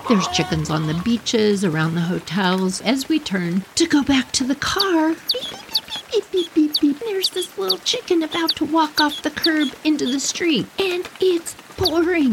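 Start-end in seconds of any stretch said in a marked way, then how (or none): 5.3-7.0 high, thin chick beeps; rapid high-pitched bird cheeps; imitated chick peeping. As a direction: peep like a lost baby chick.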